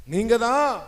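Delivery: loud swooping declaration; fervent cry. Real, not acted. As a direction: call it out to God with fervour. Preacher cries out devotion to God. (உரக்க, உருக்கமாக)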